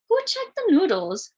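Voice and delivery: put-on high, insistent child voice; Sarah asking. A narrator's reading. Sister Sarah demanding who checked noodles, high. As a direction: press for the answer urgently. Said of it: "Sarah needed to know".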